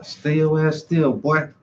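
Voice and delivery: ordinary talk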